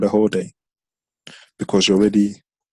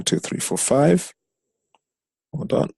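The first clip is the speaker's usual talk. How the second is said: usual speaking voice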